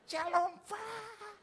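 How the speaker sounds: high, very drawn-out shalom; imitated woman's greeting. Woman standing behind greeting the preacher, high drawn-out mimicry.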